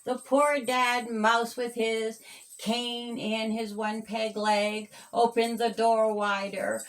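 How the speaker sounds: plain reading voice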